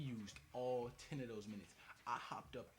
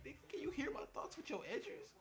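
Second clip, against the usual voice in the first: incredulous yell. Retold or imitated. Customer asking the barber if he reads minds, yelled high. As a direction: yelp the question in shock. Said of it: "I was like".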